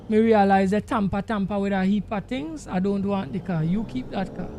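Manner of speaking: usual speaking voice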